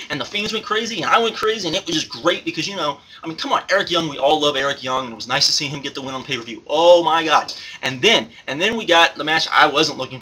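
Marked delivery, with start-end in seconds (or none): none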